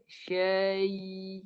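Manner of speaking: "Syai" stretched out on a level note; slow reading. Shown as a demonstration. Teacher reads slowly for the tajwid class, drawn out. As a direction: read "Syai" slowly, holding the final glide. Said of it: "saya baca pelan-pelan".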